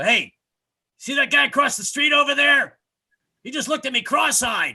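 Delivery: loud high indignant complaint; angry stranger. Retold or imitated. Stranger in the street complaining, loud high indignant. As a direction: shout it like a picking-a-fight stranger. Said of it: "people walk up to me in the street and go".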